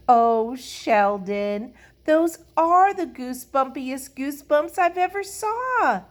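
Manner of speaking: delighted gushing. A narrator's reading. Lucille admires Sheldon's goosebumps, gushing happily. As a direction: gush with beaming admiration. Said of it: "Lucille's face beamed very happy".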